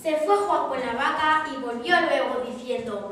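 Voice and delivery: usual speaking voice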